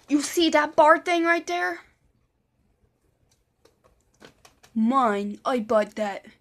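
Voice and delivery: loud, emphatic claim of ownership; possessive boasting. Retold or imitated. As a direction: imitate an overbearing neighbor laying claim. Imitates Vance boastfully claiming his things.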